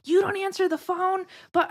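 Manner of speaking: high-pitched mock accusation; imitated indignant coworker. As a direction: throw her challenge out in a high voice. Mimics coworker's indignant challenge.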